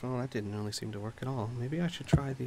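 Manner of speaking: soft, quick absent-minded mutter; murmuring to herself. Acted. Oblivious lady to herself, murmuring. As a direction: mutter it quickly under your breath. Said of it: "she is simply murmuring to herself".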